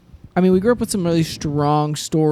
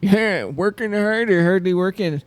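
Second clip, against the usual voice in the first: drawn-out, higher mock small talk; corny office banter; 4 semitones higher, slower than usual. Co-host riffs on slacking at the office, slow corny banter. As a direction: stretch it out like a cheesy coworker joke. Echoes co-host's office-worker joke.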